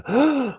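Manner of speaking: sharp, high shocked gasp; audience gasp. Acted. Audience seeing a man spit on the Buddha, shocked gasp. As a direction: suck in air like a stunned crowd. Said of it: "And everyone just gasps".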